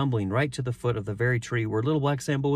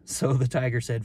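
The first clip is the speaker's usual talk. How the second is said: ordinary delivery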